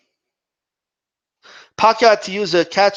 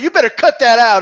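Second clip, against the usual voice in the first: mock warning; 6 semitones higher, 6 dB louder than usual. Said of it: "what Foreman told him".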